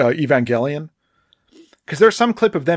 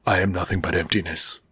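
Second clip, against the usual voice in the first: mock gloom, voice dropped lower; down on himself; 5 semitones lower, 3 dB quieter than usual. Imitating a director on his beautiful films, down on himself. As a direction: imitate a self-loathing artist, low. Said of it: "down on himself".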